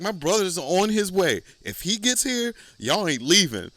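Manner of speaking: high, loud mock threat; high threatening shriek. Landlady threatens tenants that her brother is coming, high loud mock threat. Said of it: "She was like".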